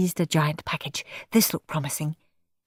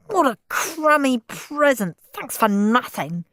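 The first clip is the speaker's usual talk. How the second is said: whiny angry; 6 semitones higher than usual. Acted. Steve's tantrum.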